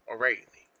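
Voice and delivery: ordinary talk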